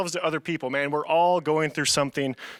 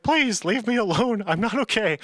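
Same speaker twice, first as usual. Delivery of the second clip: retold crying; 5 semitones higher than usual. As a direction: imitate a kid begging through tears. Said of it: "crying, like".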